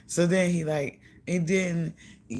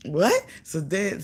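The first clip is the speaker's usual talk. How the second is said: ordinary talk, nothing marked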